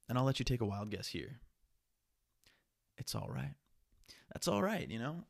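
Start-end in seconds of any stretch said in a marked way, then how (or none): 3.0-3.5 much lower soft all right; calm yourself down, low; low, soft self-reassurance. Real, not acted. Self-soothing mantra lines. 4.3-4.9 much higher sing-song all right; high, light self-reassurance. Real, not acted. Self-soothing mantra lines.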